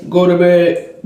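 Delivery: a hint of a stern father laying down the law; imitating the dad's command, low and firm. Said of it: "Her father thing is".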